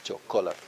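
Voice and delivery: Chocolate as cho-KO-latl, weight on KO; stress second-last syllable. Shown as a demonstration. Teacher summing up the rule, stress second-last syllable. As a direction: lean on CO in Chocolate. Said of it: "the ko is second from last".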